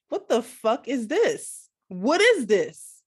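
slow, loud, stunned disbelief; shocked outburst. Retold or imitated. Retells shock at the video.

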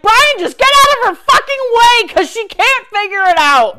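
high, loud frantic yell. Retold or imitated. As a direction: shout at him to move. Yelling at Brian in the story.

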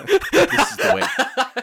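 high, slow, laughing mock creed; Mandalorian voice. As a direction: intone it like the Mandalorian.

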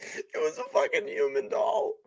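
a high whisper squeezed out while laughing; barely any voice left from laughing; wheezing laugh. Real, not acted. Cohost repeating the punchline, wheezing laugh.

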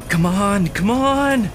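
high, impatient urging; desperate hurry-up plea; urgent. Acted. Waiting for door to open.

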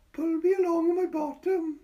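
put-on high, loud child's plea; begging child. A narrator's reading. Boy begging Patricia to pull his sledge. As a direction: beg like a small boy at play.

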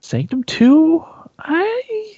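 high, slow, drawn-out disbelief; surprised. Real, not acted. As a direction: stretch it out in mock disbelief. Disbelief at the game name.

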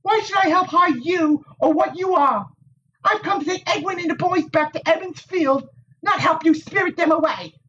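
put-on high, tart young woman's voice; prickly village Wisdom. A narrator's reading. Nynaeve to the Aes Sedai, tart and high. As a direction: needle him with a sharp tongue.

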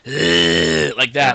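low gravelly growl from the throat. Shown as a demonstration. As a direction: grind a gravelly grunt out of the back of the throat. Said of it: "like, a gravelly voice".

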